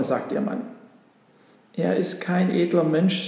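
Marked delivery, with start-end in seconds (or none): none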